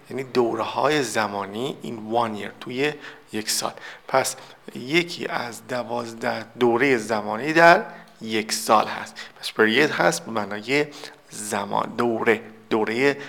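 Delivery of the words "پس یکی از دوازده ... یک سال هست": normal talking voice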